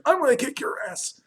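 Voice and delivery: high-pitched acted threat; hammed-up fighting words, voice shooting up; mock angry threat. Acted threat, restarted after aside.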